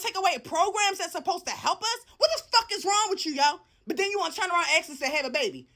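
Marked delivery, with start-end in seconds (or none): none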